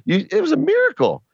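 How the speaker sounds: it was a miracle, squeaked up very high; exaggerated disbelief, way up in pitch; mock amazement. Real, not acted.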